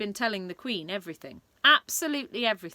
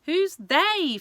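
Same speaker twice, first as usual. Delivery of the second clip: exasperated King; 10 semitones higher, 8 dB louder than usual. Acted. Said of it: "the King asked".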